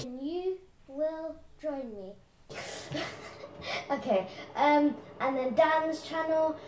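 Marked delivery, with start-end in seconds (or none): 0.0-2.1 softer repeat of the closing line; quieter repeat. Shown as a demonstration. Repeating the intro's closing line, softer. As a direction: say the closer again softly.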